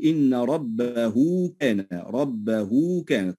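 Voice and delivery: very slow, ربه lengthened to rabbahuu before كان; pronounced waw. Shown as a demonstration. Teacher shows the waw, ربه slowly drawn out. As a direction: hold a long oo on ربه, then كان. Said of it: "وننطق واوا".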